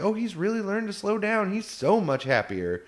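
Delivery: sweet cover. Retold or imitated. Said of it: "she's like".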